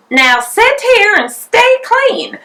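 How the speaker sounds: a fussy mother laying down the law, high and slow; stern mother. A narrator's reading. Mother instructs baby in pram.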